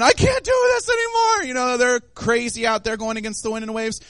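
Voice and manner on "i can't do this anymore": high-pitched mock wail. Preacher acting exhausted disciples in the storm, wailing. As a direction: wail it way up high like a desperate rower. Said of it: "wailing probably at this point".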